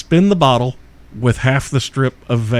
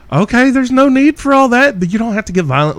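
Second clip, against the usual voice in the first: mock innocent; 9 semitones higher, 3 dB louder than usual. Backing down from arrest threat.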